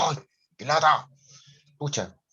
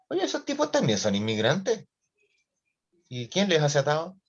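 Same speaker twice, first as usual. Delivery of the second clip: indignant question; 6 semitones higher than usual. Retold or imitated. Imagined indignant viewer.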